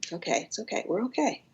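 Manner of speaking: put-on soft, hushed comforting to a little kid; quiet it down as if calming a child; gentle whispered reassurance to your inner child; soothing inner child. Shown as a demonstration. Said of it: "say that to a little kid inside yourself".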